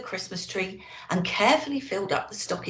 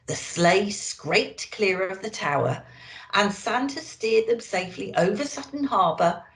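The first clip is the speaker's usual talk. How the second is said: usual speaking voice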